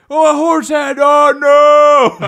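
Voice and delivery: high, very loud cry of alarm; mock horrified shout. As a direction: overplay waking up to a horror. Retold shock at finding horse head.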